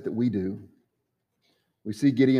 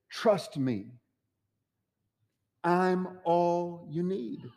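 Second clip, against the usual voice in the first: tender reassurance; 5 semitones higher, 3 dB quieter, much slower than usual. Acted. The Lord speaking to Gideon.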